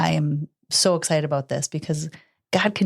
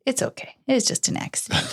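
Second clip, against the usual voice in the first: gentle reassurance; 8 semitones higher than usual. Retold or imitated. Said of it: "looked back and I said".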